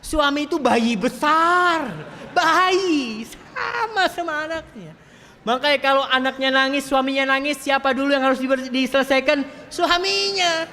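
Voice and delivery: very high, loud, ringing declaration; full-force preaching. Real, not acted. Preacher telling wives the husband is a big baby, full-force preaching. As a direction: proclaim it to the crowd at high pitch.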